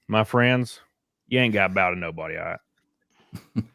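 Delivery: light Southern twang on ain't gotta bow to nobody; mock Southern boy Aragorn, quick. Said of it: "But in a redneck voice".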